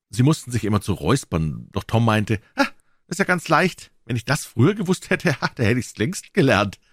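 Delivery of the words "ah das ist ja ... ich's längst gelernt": put-on high-pitched boy's voice, showing off; high, cocky kid; boastful boy Tom, breezy. A narrator's reading. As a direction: play a boy bragging, pitched well up.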